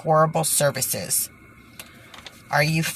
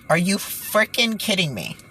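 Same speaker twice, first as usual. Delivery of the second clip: usual speaking voice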